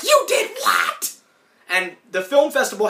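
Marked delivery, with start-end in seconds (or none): 0.0-1.1 much higher, slow disbelieving yell; shocked shout. Retold or imitated. Rocco hears the tape went to the festival, shocked shout. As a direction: shriek it in horror. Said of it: "Rocco's like".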